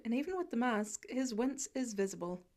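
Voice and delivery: plain reading voice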